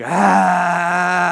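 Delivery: high, loud mock yelp; dog's startled yelp. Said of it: "and he's like".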